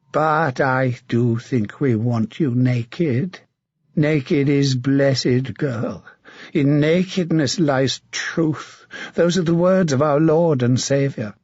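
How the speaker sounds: a mad, leering knight taunting his captive; sinister, mocking menace; a twisted zealot savouring the moment; creepy villain. Sir Martin menaces Melisande.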